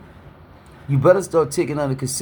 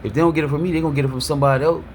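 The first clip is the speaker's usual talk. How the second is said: put-on old mindset rattled off; much faster than usual. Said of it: "I was programmed to think like".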